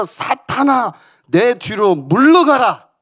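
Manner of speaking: 크고 느리게 꾸짖듯; 베드로를 물리치듯 천천히 힘주어 꾸짖어; 화난 꾸짖음. Retold or imitated. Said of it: "심지어는 화를 내면서". (화내며 베드로를 꾸짖는 예수, 크고 느린 호통)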